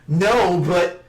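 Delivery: a touch of put-on tsundere pout; Law, jilted lover. Law sulks over their special pact, mock jilted lover. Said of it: "Like a jilted lover".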